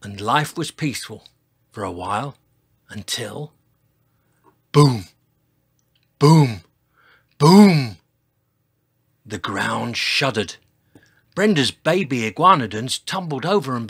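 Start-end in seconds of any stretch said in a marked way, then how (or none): 4.7-8.0 booming footsteps. A narrator's reading. Narrator imitating a giant's footsteps, booming. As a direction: sound out each heavy thud.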